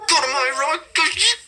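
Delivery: high, choked back-talk to parents; mock a sent-away kid's muffled shouting; suppressed yell. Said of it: "you don't want them to hear it".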